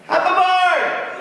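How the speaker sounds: slow, high, loud laughing bug; the bug chuckles a welcome. Acted. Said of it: "laughed the bug".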